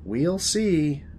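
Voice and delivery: first take, at normal volume; knowing reply. Acted. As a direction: answer with a knowing lilt. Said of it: "Did you just repeat yourself for dramatic effect?".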